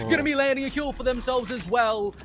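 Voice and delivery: fast, rising esports caster hype; live play-by-play. Acted. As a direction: call the action fast and climbing.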